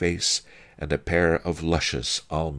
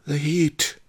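high, winded complaint; panting; 12 semitones higher than usual. A narrator's reading. Master Lee fans his face, panting. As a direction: pant it out, overcome by heat. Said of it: "Master Lee panted".

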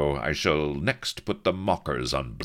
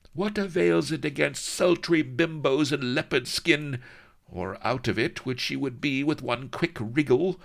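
much higher, running jealous rant; jealous lady; 12 semitones higher, 3 dB louder than usual. A narrator's reading. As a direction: scold him in one breathless stream.

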